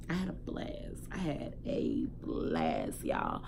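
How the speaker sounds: slow hushed whisper, dropping to a breath. Real, not acted. Telling viewers about the trip in a hushed whisper. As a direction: let it slip out in a secret whisper.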